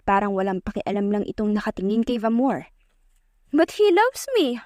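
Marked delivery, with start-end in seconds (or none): 3.5-4.6 put-on high, slow babyish coo. A narrator's reading. Aubrey coos to Damian in front of Vamor, baby voice. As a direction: coo it like a spoiled little girl. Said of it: "Baby voice na sabi ni Audrey".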